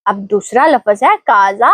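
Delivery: ordinary talk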